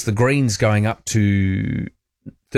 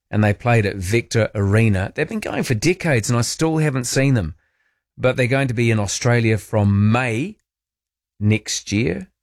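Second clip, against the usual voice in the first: usual speaking voice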